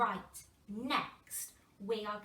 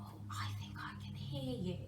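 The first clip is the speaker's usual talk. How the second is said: hushed, unvoiced whisper; 7 dB quieter, faster than usual. Shown as a demonstration. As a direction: whisper as if sharing a secret. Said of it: "I want you to whisper".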